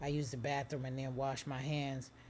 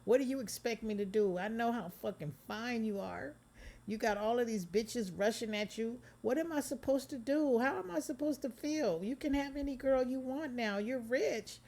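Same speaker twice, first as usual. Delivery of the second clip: very high, loud, near-crying voice; anguished outburst; 10 semitones higher, 4 dB louder than usual. Acted. Megan desperate over his fame, anguished. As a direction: let it break out of her.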